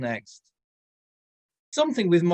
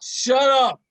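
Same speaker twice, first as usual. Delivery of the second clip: loud, higher barked order; angry snap; 7 semitones higher, 7 dB louder than usual. Acted. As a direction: snap him into silence. Balthazar snapping at patron.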